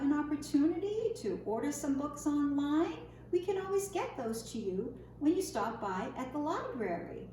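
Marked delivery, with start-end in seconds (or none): none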